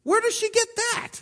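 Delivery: high, baffled squeak of disbelief; incredulous. Retold or imitated. Preacher mocking his own shock at Mary's confidence, high and incredulous. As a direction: put the book down in total disbelief. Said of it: "had to put my Bible down and go".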